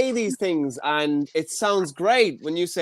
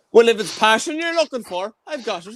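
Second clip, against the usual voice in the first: higher, hammed-up Irish accent; playful Irish flirt; 4 semitones higher than usual.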